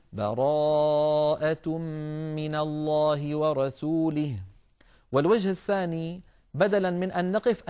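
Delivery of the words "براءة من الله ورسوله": slow recitation starting anew on براءة; fresh start after breath. Shown as a demonstration. Teacher showing the restart at Surat At-Tawbah, slow chant. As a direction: take a breath, then begin slowly on براءة. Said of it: "ثم نقول".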